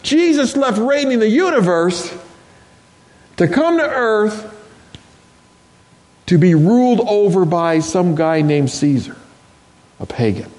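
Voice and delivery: impassioned preaching, rising and falling. Acted. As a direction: preach it with full conviction. Preacher building up to climax.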